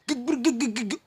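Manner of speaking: loud, high stuttering punch sounds; imitated flurry of blows. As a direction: mimic punches landing in a scuffle.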